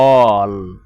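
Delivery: অল- cut short with an open o; open o demo. Shown as a demonstration. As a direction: stretch the open o in অল-. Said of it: "অল্প বলছি না?". (বিবৃত অ উচ্চারণ দেখানো)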